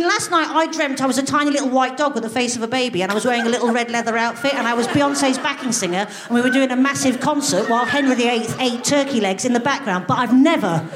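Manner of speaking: normal talking voice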